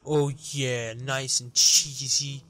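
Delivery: slow, drawn-out savouring; hammed-up slow indulgence over food; mock sensual relish. Gamer piling cheese on a pizza, slow mock-sensual relish.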